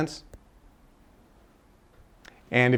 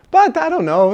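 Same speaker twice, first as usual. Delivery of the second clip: carefree shrug; 14 semitones higher, 7 dB louder than usual. Retold or imitated. Billionaire shrugging off disaster.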